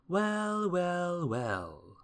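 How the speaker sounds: high, drawn-out wells stepping down; exclaiming. A narrator's reading. Wizard seeing the spilt money, exclaiming in long falling notes. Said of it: "exclaimed the wizard".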